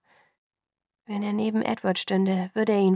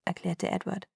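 usual speaking voice

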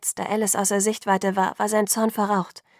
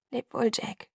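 sorrowful farewell; 3 semitones higher, 5 dB quieter, slower than usual. A narrator's reading. Bella bids Jacob farewell, sad and soft. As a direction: say goodbye gently through held-back tears.

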